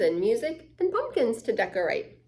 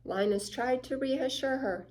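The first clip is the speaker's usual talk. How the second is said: ordinary delivery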